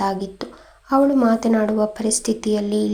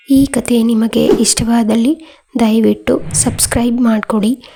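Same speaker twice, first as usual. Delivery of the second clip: louder, upbeat subscribe-and-like pitch; outro appeal; 7 dB louder than usual. Acted. Storyteller asking listeners to subscribe, in a louder eager ad read.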